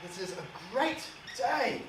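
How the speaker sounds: high, loud celebratory cheer from a father; big, insistent high-pitched enthusiasm; boisterous urging. Acted. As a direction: coax your son to join the party. Father urges son to celebrate.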